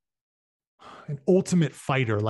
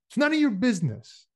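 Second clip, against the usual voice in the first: fast, much higher, louder retort; angry retort; 10 semitones higher, 4 dB louder, faster than usual. Acted. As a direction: hurry the insult out in anger. Ernie snapping at Wilson.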